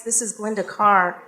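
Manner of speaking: normal talking voice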